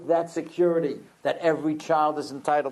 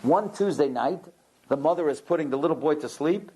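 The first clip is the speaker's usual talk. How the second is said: normal talking voice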